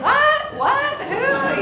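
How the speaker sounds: very loud, high-pitched hard-of-hearing phone yell; mock deaf shouting. Poking fun at two old men on the phone, shouting. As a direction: blast it out like a ninety-something on the phone. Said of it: "I don't wanna blast your ears out".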